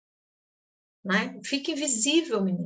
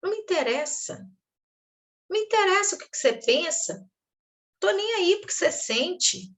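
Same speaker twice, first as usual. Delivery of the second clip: higher, cold dismissive voice; uninterested parent; 6 semitones higher than usual. Acted. Parent telling a child their feelings don't matter, cold and dismissive. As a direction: shut the child out, couldn't care less. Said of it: "Quem é que não se interessava?".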